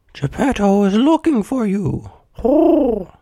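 high hooting bird voice; calling pigeon. A narrator's reading. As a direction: call out high, ending on a hoot. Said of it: "called a pigeon".

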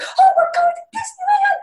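put-on very high, giddy little-girl squeal; hammed-up childlike glee; happiest little girl. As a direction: skip along squealing with joy. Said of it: "happiest little girl you've ever seen".

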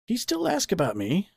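high, tentative question; pondering question. A narrator's reading. Father asks about the bear, pondering. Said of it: "before he pondered".

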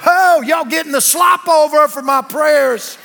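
loud, high, drawn-out whoop; exultant holler. Acted. Preacher replays his silent reaction on the plane, exultant holler.

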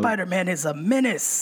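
lightly hammed-up newspaper boss outburst; J. Jonah Jameson. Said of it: "who played J. Jonah Jameson".

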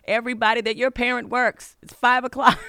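loud, high, exasperated snap at her daughter; mother snapping back at upset daughter, loud and angry; angry retort. Retold or imitated. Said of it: "And I was mad".

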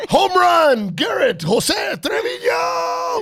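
very high, loud, slow home-run call; baseball announcer. Acted. Dad announcing his son's home run, booming announcer. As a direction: stretch the name out like a stadium call. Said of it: "that sounds like a, an announcement".

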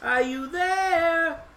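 put-on high, drawn-out girlish call. A narrator's reading. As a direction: stretch it out as a searching call. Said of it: "she called".

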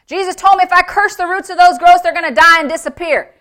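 high, loud and insistent; urgent announcement. Acted. Norval bursts in announcing to his daughter, loudly urgent. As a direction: proclaim it in an acted fired-up outburst.

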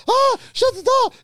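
very high, loud panicked squeal; startled shriek. Retold or imitated. Naked man to the chambermaid bursting in, high panicked shriek. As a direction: shriek it in falsetto. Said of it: "I was, I was like".

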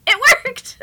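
high, loud, gleeful squeal through laughter; laughing amazement. Retold or imitated. Mom telling how the swearing worked, laughing amazement. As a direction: burst out in delighted disbelief.

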